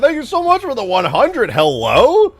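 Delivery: real shock, then loud thrilled yelling, high and loud; screaming with real surprise and joy; startled excited shouting. Real, not acted. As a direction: blown away by a huge donation, shouting it out. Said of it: "you didn't have to scare me".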